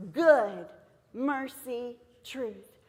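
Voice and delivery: name each blue word as its own step; stressing key words. Shown as a demonstration. Recapping the verse's key words for teachers, one by one. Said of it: "the three blue words".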